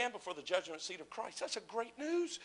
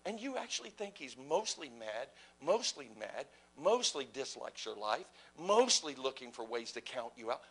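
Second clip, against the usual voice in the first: normal talking voice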